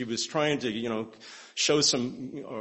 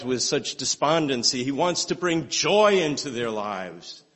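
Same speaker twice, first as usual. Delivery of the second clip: raised, fervent preaching voice; 4 semitones higher, 6 dB louder than usual. Acted. Preacher urging the congregation that Jesus saves, fervent preaching. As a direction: preach it out to the whole church.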